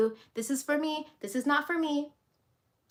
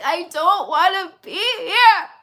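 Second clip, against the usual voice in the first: high, loud bawling tantrum; hammed-up sobbing child's meltdown; crying inner child; 13 semitones higher, 10 dB louder than usual. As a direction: wail it like a sobbing child, much higher and louder. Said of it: "your inner child is crying, she's sobbing".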